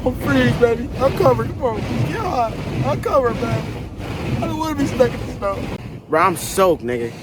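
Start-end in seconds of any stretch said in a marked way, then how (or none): 0.1-5.6 high, whiny freezing complaint; whining distress. Real, not acted. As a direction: whimper about the cold, half panicked. Cold, stuck in snowy car.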